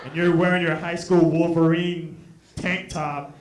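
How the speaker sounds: low-pitched, unimpressed reply; dry, low put-down; deadpan comeback. Acted. Deadpan reply to threatening boy.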